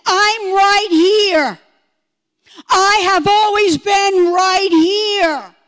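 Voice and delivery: loud, slow, drawn-out proclamation; God's declaration. Retold or imitated. Testifier retelling God showing up over her, booming declaration. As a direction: proclaim it as God, stretching each word. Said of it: "and said".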